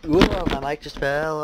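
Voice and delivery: loud slow startle; jolted by the falling mic; startled whoa. Real, not acted. Mic falls.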